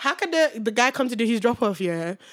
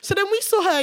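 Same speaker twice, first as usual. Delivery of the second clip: ordinary delivery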